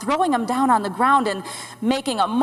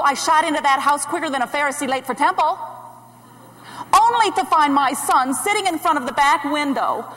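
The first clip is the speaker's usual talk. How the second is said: normal talking voice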